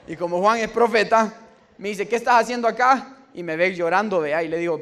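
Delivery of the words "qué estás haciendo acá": loud, much higher demanding question; prophet's loud challenge. Retold or imitated. Prophet Juan confronting the crying [name], loud and high. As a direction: call it out like a prophet catching him.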